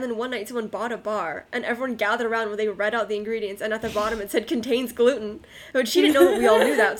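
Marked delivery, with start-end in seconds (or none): 4.5-7.0 loud, building climax of the story; excited reveal. Real, not acted. Telling friends about the gluten-free girl, excited and loud. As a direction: punch the reveal loudly.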